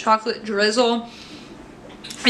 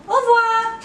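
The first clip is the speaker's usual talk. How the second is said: cheery sign-off; 12 semitones higher than usual. Real, not acted. Signing off video.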